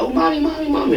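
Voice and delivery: high-pitched imitation of a child calling mommy; little girl. Little girl in the movie calls her mother while shopping, imitated high child voice. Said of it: "the little girl shopping. She like".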